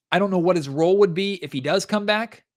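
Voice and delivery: ordinary talk, nothing marked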